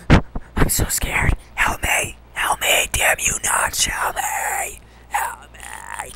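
breathy terrified whisper; mock scared whisper. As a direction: whisper pleas for help in the dark.

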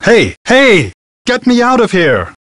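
high-pitched, urgent call for help. Acted. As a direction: call out sharply to be let out. Trapped, calling for help.

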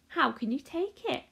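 plain reading voice

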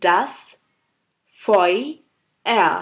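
das, pause, Feuer drawn out as foy-ea; broken into parts. Shown as a demonstration. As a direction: split das Feuer slowly into syllables. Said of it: "আবার আমি ভেঙে ভেঙে বলি". (ভেঙে ভেঙে বলা das Feuer)

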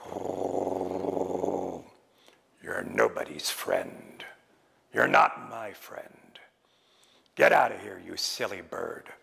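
very low, growly wolf voice; deep, menacing dismissal of a pest; surly wolf. Acted. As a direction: rumble a scornful rebuff. Wolf answers Raven with scorn.

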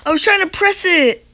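high-pitched frantic complaint; flustered outburst. Real, not acted. Hider failing to press the button, flustered high outburst.

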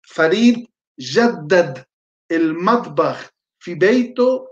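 slow, careful dictation of an Arabic sentence. Shown as a demonstration. Teacher dictates a sentence for students to translate, slowly. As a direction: spell it out word by word for note-takers. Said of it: "con atención y con rapidez puedan ustedes traducir".

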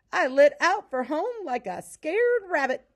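high, loud, animated storyteller's dialogue; excited telling. A narrator's reading. Pa telling Laura how he fled home, excitedly. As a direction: play up the fright of the escape.

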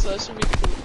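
ordinary talk

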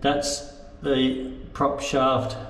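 usual speaking voice

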